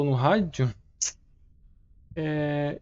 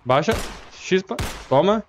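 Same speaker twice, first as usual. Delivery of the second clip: being chased; 6 semitones higher than usual. Real, not acted. Fleeing monster in game.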